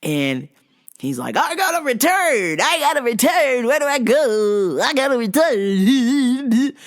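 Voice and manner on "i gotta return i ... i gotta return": high, loud frantic mock old customer; spazzing old man. Mocking the frantic customer demanding a return, high and loud. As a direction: squawk it like a panicky old man. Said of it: "Just fucking spazzing out".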